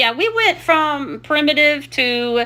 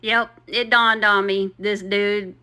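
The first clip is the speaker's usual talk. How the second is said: ordinary talk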